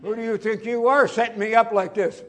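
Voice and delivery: loud, high imitating indignation; mock outrage. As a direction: play up your retold outburst. Upset pastor confronting the prankster.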